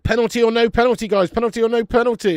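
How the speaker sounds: loud urgent question to the viewers; loud call-out. Real, not acted. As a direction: shout the question to the chat. Calling out to chat during replay.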